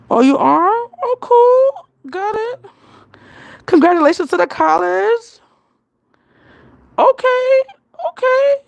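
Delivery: high, loud, gushing replies; excited reaction. Real, not acted. Vlogger congratulating a live viewer on college, excited. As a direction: cheer them on in a high, loud voice.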